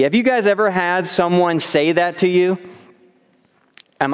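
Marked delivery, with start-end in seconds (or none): none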